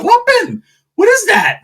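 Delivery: high, loud startled cry; shocked double take. Retold or imitated. Seeing his tio's Bulldog tattoo, high startled cry. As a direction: stop everything in shock.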